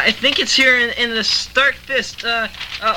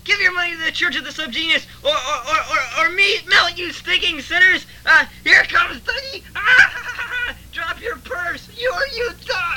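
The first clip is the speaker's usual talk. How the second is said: fire and brimstone preaching; 6 semitones higher than usual. Acted. Preaches to the sinners.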